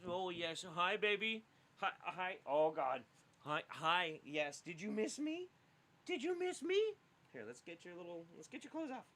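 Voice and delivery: high, soft cooing to a puppy. Real, not acted. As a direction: coo sweetly at the little dog. Greeting the puppy.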